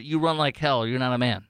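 normal talking voice

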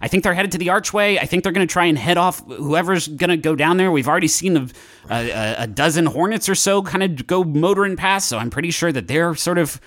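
flustered run-on phone report; Jake Cool. Acted. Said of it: "And he says".

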